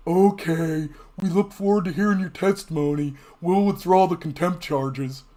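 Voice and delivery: a hint of a timid official giving in; meek Republicans. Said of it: "what did Republicans do?".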